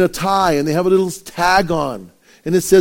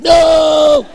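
high comic yelled no; mock shout; 11 semitones higher, 8 dB louder than usual. Pastor dreading the debate, mock-shouting no. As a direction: yell no in mock panic. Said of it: "I'm like".

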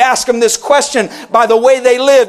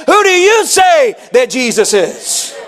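fervent, raised preacher's question; preached question; 3 semitones higher, slower than usual. Acted. Pastor thundering the key question at the church. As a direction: drive the question home.

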